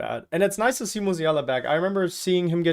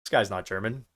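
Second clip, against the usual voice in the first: low, softer dismissive quote; imitated skeptic; 7 semitones lower, 4 dB quieter than usual. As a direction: imitate a doubter sizing someone up, lower. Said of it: "take one look at me and be like".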